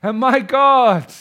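loud, high cry of faith; exclaimed in awe. Acted. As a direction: burst out with it. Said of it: "Something in him finally says".

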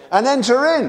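enter in shouted high and loud, enter stressed; preaching climax. Acted. Climax of exhortation.

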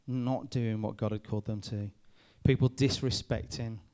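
ordinary talk, nothing marked

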